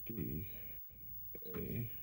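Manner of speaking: ordinary talk, nothing marked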